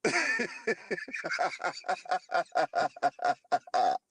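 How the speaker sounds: long, rattling cackle; long staccato laugh. Real, not acted. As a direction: laugh stuttering downward in pitch. Laughing at his own joke.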